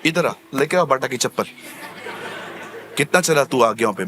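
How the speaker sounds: stern God. Pretend. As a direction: call him over and demand an answer.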